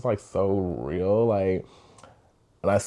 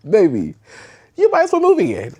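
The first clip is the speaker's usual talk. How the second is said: laughing, high and louder; a high giggly jab at herself; laughing high mock advice; laughing tease; 11 semitones higher, 6 dB louder than usual. Real, not acted. Teasing herself about moving in.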